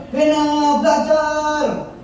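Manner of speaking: high, slow, drawn-out command; scolding parent. Retold or imitated. Father ordering son Bena to study, high and drawn out. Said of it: "Akhirnya udah".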